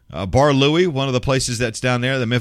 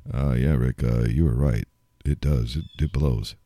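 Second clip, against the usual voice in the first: much lower, dopey mock voice of latecomers; sheepish admission; 12 semitones lower than usual. People who disagreed admit to Rick he was right, in a low dopey mock voice. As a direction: drawl it like a slow sheepish guy.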